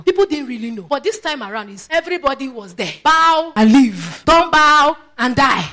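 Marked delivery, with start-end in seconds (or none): 3.0-5.7 loud, slow, stark pronouncement; proclaimed ultimatum. Acted. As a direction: declare the choice to a whole crowd, drawn out. Proclaims the king's ultimatum publicly.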